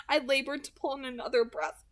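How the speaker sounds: ordinary delivery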